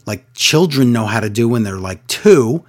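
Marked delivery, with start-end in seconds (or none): none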